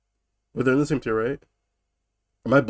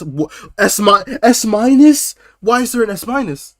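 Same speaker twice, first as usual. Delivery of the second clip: incredulous; 7 semitones higher, 6 dB louder than usual. Real, not acted. Mocking tier names in disbelief.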